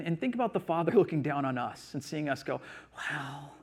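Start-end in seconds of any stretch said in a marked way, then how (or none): 3.0-3.5 soft, slow complaining with weary sighs; grumbling excuses. Retold or imitated. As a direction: mimic a reluctant, put-upon churchgoer. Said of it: "we get into these moods".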